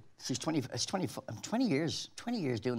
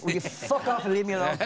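laughing retort; 5 semitones higher, 4 dB louder than usual. Retold or imitated.

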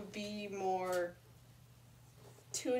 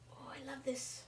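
whispering; 2 semitones higher, 8 dB quieter than usual. Real, not acted. Whispered delight.

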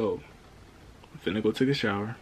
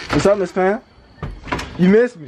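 excited greeting; 10 semitones higher, 10 dB louder than usual. Real, not acted. Greeting Miss Pam on arrival.

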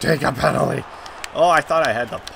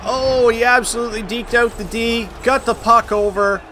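high, loud, excited praise; thrilled goal celebration; 7 semitones higher, 4 dB louder than usual. Real, not acted. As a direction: marvel at a brilliant play.

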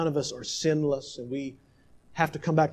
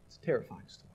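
soft hushed echo; 5 semitones lower, 9 dB quieter, much faster than usual. Acted. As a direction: repeat it softer, almost to yourself. Echoes line much softer.

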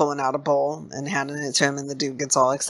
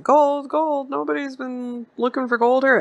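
high-pitched mock scoffing, imitating the old man; playing it off; 10 semitones higher than usual. Old dude trying to play it off, high mock scoff. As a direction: scoff it away in a raised, put-on voice. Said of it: "he starts laughing all hard".

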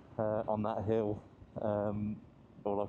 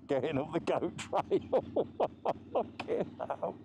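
amused, higher-pitched, breaking into laughter; helpless chuckling between phrases; real laughing; 6 semitones higher than usual. Real, not acted. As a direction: laugh your way through the words. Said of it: "I'm laughing 'cause".

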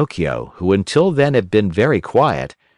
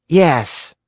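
put-on whiny puppet boy; 7 semitones higher than usual. A narrator's reading. Pinocchio refuses the fairy's bitter medicine, whining. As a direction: refuse like a stubborn child.

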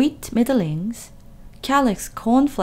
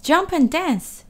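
higher rooster voice; rooster calling; 8 semitones higher, 3 dB louder than usual. A narrator's reading. Rooster calling to Wilbur, higher. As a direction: call it like a rooster. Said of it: "said the rooster".

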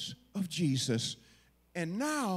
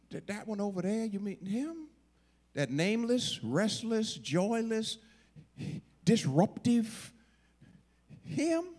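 pitched-up, incredulous list of faults; disdainful disbelief; 5 semitones higher than usual. Acted. Disciples asking Jesus if he means that man, incredulous. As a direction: draw out "disruptive" and lift "him".

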